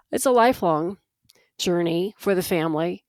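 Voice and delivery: ordinary talk, nothing marked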